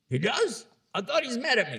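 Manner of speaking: high-pitched, surprised question; surprised brother. Acted. Estranged brother answering the peacemaker who says he likes him, surprised. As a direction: play mild disbelief, voice lifted high.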